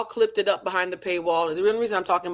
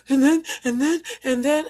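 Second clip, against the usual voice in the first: mock stammering confession; 9 semitones higher, 3 dB louder than usual. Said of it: "they'll do this whole".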